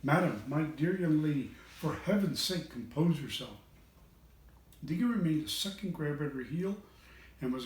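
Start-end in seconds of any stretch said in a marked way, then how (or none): none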